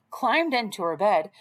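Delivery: plain reading voice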